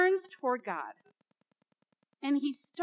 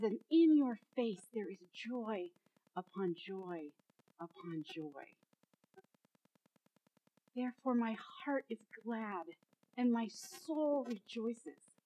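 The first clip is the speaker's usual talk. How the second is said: softer and lower, fading to near hush, slowing; hushed awe; 4 semitones lower, 7 dB quieter than usual. Acted. Preacher voicing David before God's face, hushed and slow. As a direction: breathe the words in wonder.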